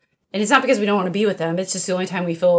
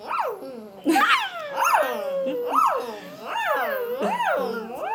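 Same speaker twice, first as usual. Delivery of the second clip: high squeaky dolphin chatter; dolphin squeal; 4 dB quieter than usual. Pretend. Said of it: "Is that what a dolphin sounds like?".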